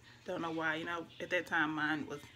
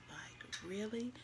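breathy whispered disbelief, slow; stunned disbelief; 2 semitones higher, 9 dB quieter, slower than usual. Retold or imitated. Woman recalling news of the death, whispered disbelief. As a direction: barely voice the question.

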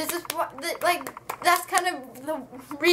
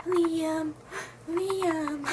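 soft, higher, pining imitation; mock plaintive call; 4 semitones higher, 5 dB quieter than usual. Ruby running away from the house, mock plaintive call. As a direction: call the name weakly, mocking a lovelorn heroine.

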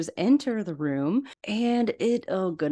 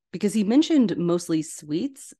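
normal talking voice

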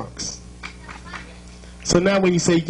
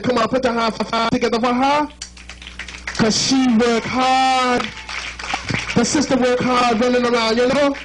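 hype; 5 semitones higher than usual. Real, not acted. Hyping crowd for [name].

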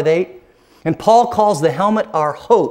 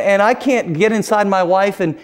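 normal talking voice

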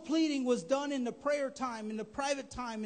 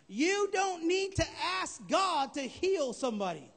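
shouted, higher sermon punch line; full-force preaching; 5 semitones higher, 4 dB louder than usual. Acted. Preacher shouting a bold claim at the congregation. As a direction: hit the congregation with it at full volume.

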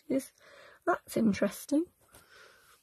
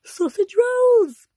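much higher, louder, slow and sung out; excited announcement; 9 semitones higher, 7 dB louder, much slower than usual. Real, not acted. Reading the next recipe title to viewers, excited. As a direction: announce it like a treat.

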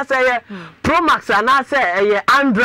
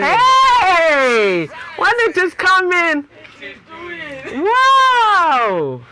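excited shout; 6 semitones higher, much slower than usual. Real, not acted. Host reacts to caller's story.

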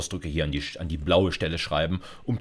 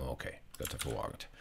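whispered aside to yourself; soft whispered relief after finding it; muttered aside; 7 semitones lower, 16 dB quieter, faster than usual. Real, not acted. Muttering to self while searching.

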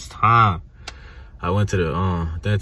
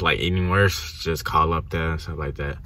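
normal talking voice

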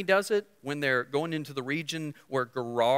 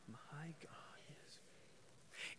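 whispered declaration; 29 dB quieter, much slower than usual. Acted. Preacher whispering the promise to the congregation. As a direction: drop to a breathless whisper.

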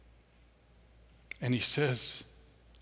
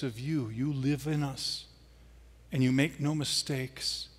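closing prayer; 3 semitones lower than usual. Real, not acted. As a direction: pray it gently over them.

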